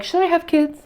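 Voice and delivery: a hint of self-doubt, voice lifted high; musing question. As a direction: wonder aloud, half to herself. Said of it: "where I'm like".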